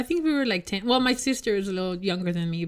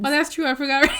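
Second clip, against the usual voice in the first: normal talking voice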